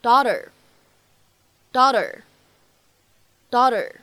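"daughter" with the t flapped, dau-der, drawn out slowly. Shown as a demonstration. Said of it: "会读成". (美式闪音 "daughter"，慢读)

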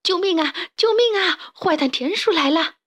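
high, mounting panicked calls; shrill frightened yelling; crying for help. Acted. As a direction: raise the alarm in a panic. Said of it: "忽然听到大嗓门的呼喊".

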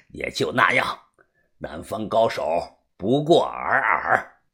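low, soft scornful chuckle; chuckle, then dismiss them slowly; laughing disdain. A narrator's reading. Master belittles southern fighters, low laughing disdain. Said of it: "摊开手笑道".

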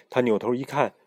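ordinary delivery

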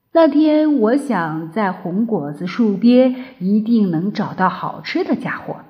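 ordinary delivery, nothing marked

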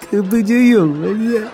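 laughing through the punchline; cracking up mid-sentence. Real, not acted. Laughing at own joke.

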